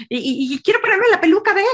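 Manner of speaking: put-on little kid voice. Imitating little boy asking.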